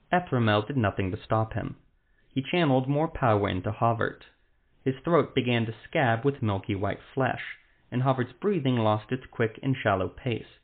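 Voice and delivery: ordinary delivery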